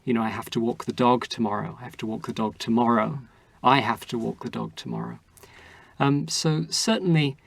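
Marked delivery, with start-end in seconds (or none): 0.2-1.8 dog stressed, the rest of the sentence light. Shown as a demonstration. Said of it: "placing emphasis on just about every single word". 1.8-3.2 tomorrow stressed with a rising end. Shown as a demonstration. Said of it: "placing emphasis on just about every single word". 3.6-5.2 I punched at the start of the sentence; stress on I. Shown as a demonstration. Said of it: "placing emphasis on just about every single word".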